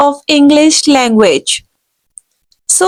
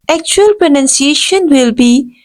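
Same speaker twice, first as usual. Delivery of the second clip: ordinary talk, nothing marked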